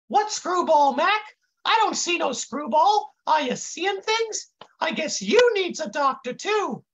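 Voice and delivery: high, clueless intern voice; high kid voice. A narrator's reading. Confused kid looks round for the screwball, high voice. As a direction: innocent and way up high. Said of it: "the kid says in this high voice".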